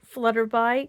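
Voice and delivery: ordinary delivery, nothing marked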